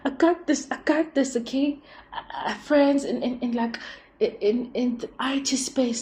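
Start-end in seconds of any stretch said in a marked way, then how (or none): 0.0-1.4 higher, bright mock boyfriend reassurance; hammed-up soothing babe; imagined confident partner. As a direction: play the partner who has it handled. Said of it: "someone's gonna be like".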